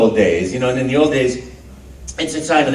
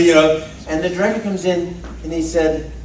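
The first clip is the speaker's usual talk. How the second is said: ordinary delivery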